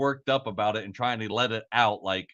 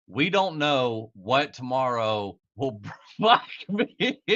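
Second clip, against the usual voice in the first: slow reading that collapses into laughter; fail to hold back a real laugh; breaking into laughter; slower than usual. Real, not acted. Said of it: "just sitting here fucking laughing".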